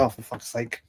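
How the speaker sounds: low, soft muttered swearing. Retold or imitated. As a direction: grumble it under your breath. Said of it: "I was like".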